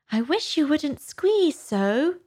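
high, slow Dormouse whine; slow, high-pitched little creature; Dormouse complaining. A narrator's reading. Dormouse complains at being squeezed.

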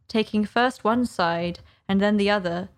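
ordinary delivery

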